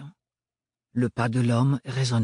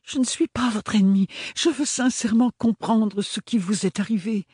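earnest high-pitched plea; 9 semitones higher than usual. Acted. She pleads sincerely with attacker.

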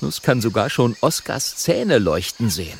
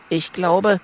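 little dragon; 8 semitones higher than usual. Acted.

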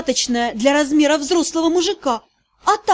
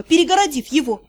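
usual speaking voice

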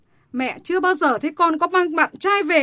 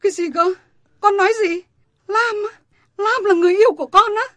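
higher and louder, fast and incredulous; stunned disbelief; 7 semitones higher, 7 dB louder than usual. Acted. Mother learning her daughter loves Lam, outraged disbelief. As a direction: refuse to believe what you hear.

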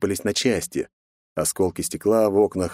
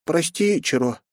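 sad sigh; 8 semitones higher, slower than usual. Acted. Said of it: "с грустью вздохнула она".

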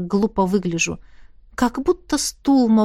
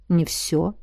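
soft, lower and quieter; softly; 5 semitones lower, 4 dB quieter than usual. A narrator's reading. Roderick gently correcting the heroine, soft and low. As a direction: say it gently, dropping the voice. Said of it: "мягко ответил Родерик".

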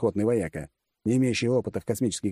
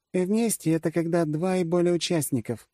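higher voice of the woman commander; gadyuka explaining; 10 semitones higher than usual. A narrator's reading. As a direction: spell it out in a high female voice. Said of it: "пояснила мне гадюка". (поясняет высоким женским голосом)

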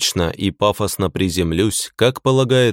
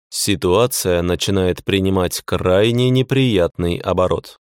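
ordinary delivery

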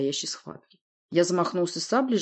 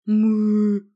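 mumbling through a gag; muffled moan through a gag; gagged; 4 semitones higher, 7 dB louder than usual. A narrator's reading. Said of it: "промычала насквозь Кляп".

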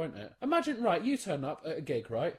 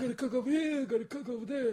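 a Jackie Mason kvetch, higher than usual; Jackie Mason impression; 5 semitones higher, faster than usual. Defending his act to the co-host: mock Jackie Mason. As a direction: sell it like a Borscht Belt comic. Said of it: "Jackie Mason is it I'm doing".

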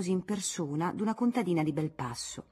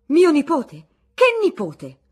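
much higher and louder, indignant yelling; bawling; 9 semitones higher, 14 dB louder than usual. Acted. Aunt Lucrezia bawling at Teresa about her nephew. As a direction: shout the question back in outrage. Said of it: "vociferava l'altra".

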